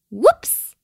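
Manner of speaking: high, loud little oops; startled whoops. A narrator's reading. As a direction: pop it out high after a sneeze. Little kiwi just sneezed.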